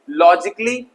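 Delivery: ordinary talk, nothing marked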